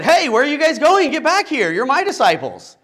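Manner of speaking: high, loud, indignant call after them; mock outrage at deserting disciples; possessive callback. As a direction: shout them back like a jealous teacher. Imagined possessive John calling back.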